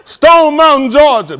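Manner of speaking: very loud, ringing place-name proclamation; mock oratory. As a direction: hurl it out like a rally speaker. Parodies a famous speech.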